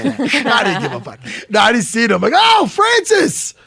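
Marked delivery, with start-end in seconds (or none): 2.4-3.5 high, loud, drawn-out gushing cry; gushing greeting. Retold or imitated. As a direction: squeal the greeting slowly, pitched way up. Acts out greeting Francis excitedly.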